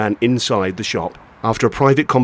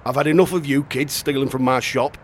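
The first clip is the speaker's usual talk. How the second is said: ordinary delivery, nothing marked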